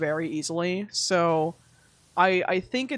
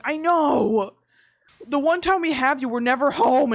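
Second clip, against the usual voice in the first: louder, higher, frustrated wail; exasperated agreement; 5 semitones higher, 5 dB louder than usual. Retold or imitated. Answering her cat, loudly guilty about never being home. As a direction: throw your hands up, guilty.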